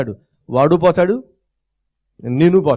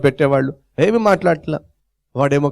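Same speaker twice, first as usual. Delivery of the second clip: usual speaking voice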